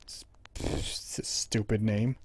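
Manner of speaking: soft, slow muttered scoff; muttered aside. Real, not acted. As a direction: scoff quietly to yourself. Muttering about dog's name.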